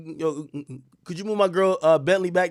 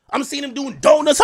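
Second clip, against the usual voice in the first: ordinary talk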